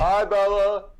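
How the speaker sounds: calling to a dog. Real, not acted. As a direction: call out so the dog hears.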